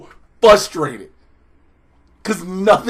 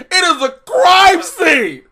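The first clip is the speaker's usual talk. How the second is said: slow, higher, louder yell of disbelief; exasperated shout; 9 semitones higher, 5 dB louder, much slower than usual. Real, not acted. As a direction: spell it out to an idiotic show. Ranting at the show.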